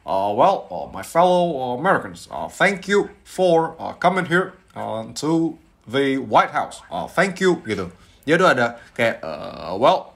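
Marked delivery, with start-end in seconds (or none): 0.0-7.6 halting, deliberate presidential speech; Obama. Shown as a demonstration. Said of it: "em, Obama". 9.2-10.2 halting presidential hesitation; low filler, then a lifted word; Obama. Shown as a demonstration. Impressionist repeating the president's halting opener. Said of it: "misalnya Obama kan kayak".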